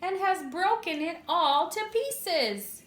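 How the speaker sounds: high squeaky cub voice, slowing at the end; Baby Bear's squeak. A narrator's reading. Narrator reading to children as Baby Bear over his broken chair, high squeak. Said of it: "He squeaked in his little tiny voice".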